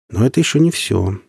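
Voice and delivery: ordinary delivery, nothing marked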